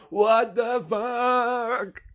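very slow, high, loud mock shout; excited echo. [name] echoes the truck driver, imitated in a long excited shout. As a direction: stretch every word into a huge yell. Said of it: "he's almost like an emphasizer".

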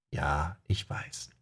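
soft, slow admission; quiet sheepish. Acted. Leroy admitting his friend is wrong, soft and slow. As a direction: mumble it softly and slowly.

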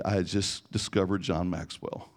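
ordinary delivery

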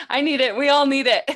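loud, high excited cry; excited exclaim. Real, not acted. Host loudly agreeing she needs good news. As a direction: burst out with eager agreement.

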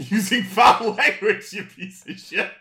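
slow reprimand collapsing into laughs; laughing mock scolding. Real, not acted. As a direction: start formal, break into loud, high laughter. Joke scolding of chatter.

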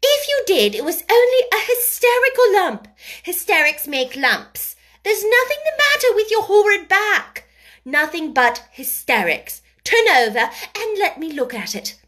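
put-on high, loud, cross child; fierce scolding. A narrator's reading. Mary scolds the boy about his back, fiercely. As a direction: scold him hard in a high voice. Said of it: "contradicted Mary fiercely".

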